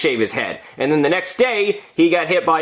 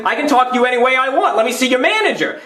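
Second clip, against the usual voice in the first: raised, demanding rant; angry demand; 9 semitones higher than usual. Retold or imitated. Escalating restaurant rant.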